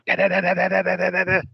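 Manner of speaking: rapid high-pitched "da-da-da" gunfire noise; imitated machine-gun fire. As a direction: rattle it off like a toy machine gun. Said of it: "and then they go".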